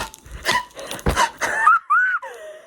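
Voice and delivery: very high wheezy squeal, half laugh; squealing laugh-cry. Real, not acted. Reader overcome by a book scene, squealing gasp.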